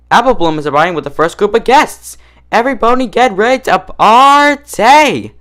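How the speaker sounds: high, loud, stretched-out party call; rally every pony to get ready; excited exclamation. Acted. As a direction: Pinkie Pie shouting to all the ponies, thrilled. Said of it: "she exclaimed".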